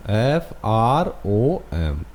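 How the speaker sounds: letters spelled out very slowly; slow spelling. Shown as a demonstration. Teacher spelling a word for students, very slow. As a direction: dictate each letter, drawn out. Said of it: "इसको आप कैसे लिखोगे".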